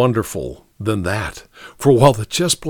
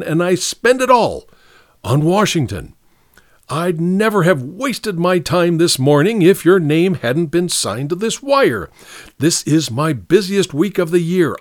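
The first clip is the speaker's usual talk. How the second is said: indignant antiquarian; 6 semitones higher than usual. Acted. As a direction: puff up with self-importance and hold the pitch high. Patch's indignant speech.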